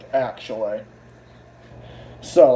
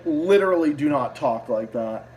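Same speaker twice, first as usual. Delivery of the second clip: ordinary delivery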